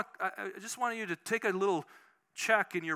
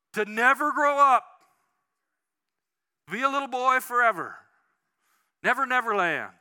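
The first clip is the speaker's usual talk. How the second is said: loud preaching; 5 semitones higher, 8 dB louder than usual. Acted. Preaching Peter Pan's wish.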